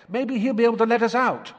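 put-on high, loud, jostled boy crying out; squashed in a chimney, yelping at his brother; panicked twin in the chimney. A narrator's reading.

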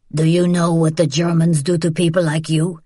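mild threat in the rough man's altered voice; whispered menace. A narrator's reading. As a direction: threaten up close, without really whispering. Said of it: "he whispered".

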